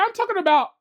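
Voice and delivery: high, loud, incredulous protest; retelling his reply to a commenter, exasperated and loud; exasperated retort. Retold or imitated. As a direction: throw your hands up in disbelief. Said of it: "I was like".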